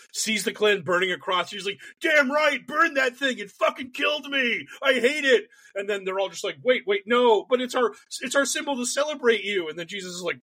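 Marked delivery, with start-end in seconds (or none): 2.0-5.5 high-pitched, worked-up Jesus rant; indignant mock shout. Jesus cheering on cross burning. 6.6-9.7 high, pleading mock objection; flustered protest. Klansmen protesting to Jesus.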